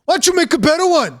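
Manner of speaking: a waiter scoffing at a complaining diner; snippy waiter. Retold or imitated. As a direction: fire it back indignantly. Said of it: "sir, there's two pubes in my plate".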